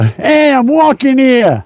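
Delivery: high, punchy New York-Boston street voice; mock Boston accent. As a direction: belt it out like a Boston wiseguy. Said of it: "they have a punch to their voice".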